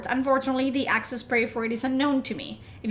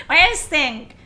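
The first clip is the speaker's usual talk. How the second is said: "What do you guys think?" repeated much louder, falling at the end; 3 semitones higher, 8 dB louder than usual. Real, not acted. Streamer calls louder to chat for opinions.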